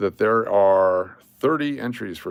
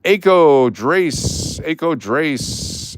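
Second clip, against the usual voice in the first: calling out a name; 6 semitones higher, 7 dB louder, much slower than usual. Real, not acted. Calling out the giveaway winner.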